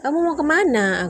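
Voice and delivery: chasing after husband who walks off; retold shout after him; calling after him. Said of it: "Jadi aku kejar dia kan".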